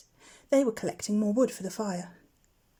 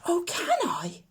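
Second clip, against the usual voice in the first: higher, whispery eager plea; a baby owl thrilled at the invitation; cried; 9 semitones higher, slower than usual. A narrator's reading. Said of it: "cried Plop".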